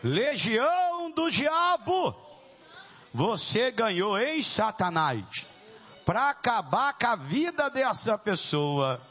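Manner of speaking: high, shouted challenge to a demon; thundering deliverance preacher; full-force exorcism preaching. Real, not acted. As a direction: confront the evil spirit, pitch climbing high. Confronts the demon before congregation.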